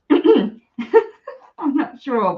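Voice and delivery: ordinary talk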